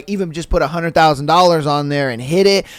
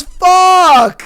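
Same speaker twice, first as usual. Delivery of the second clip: high, loud, drawn-out curse; booming yell; 11 semitones higher, 5 dB louder than usual. Acted. Booming voice sees the lost bet, yelled curse. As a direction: bellow it as the joke's punchline. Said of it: "The booming voice yells".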